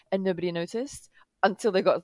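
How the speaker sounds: ordinary talk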